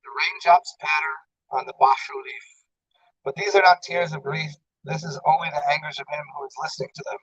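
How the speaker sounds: understated poetry reading; poem reading. Acted. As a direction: let the verse drift out softly. Recites short poems.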